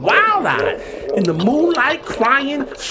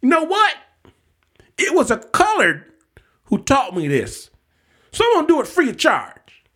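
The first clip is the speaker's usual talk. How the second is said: high, loud folksy boast; a showman booming at the crowd; loud retelling; 9 semitones higher than usual. Retold or imitated. Witcher telling the father, loud and high. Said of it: "says to my father loudly".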